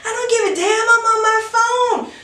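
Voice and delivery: high mock girl. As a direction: squeak it out like a bratty girl. Mocking drunk girl's retort.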